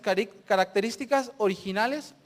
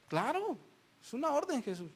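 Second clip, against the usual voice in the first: higher, softer voice of reply; God answering Jesus; 4 semitones higher, 8 dB quieter than usual. Acted. God confirming the order to Jesus, higher and soft. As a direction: give the order lightly and softly.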